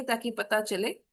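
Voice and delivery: ordinary delivery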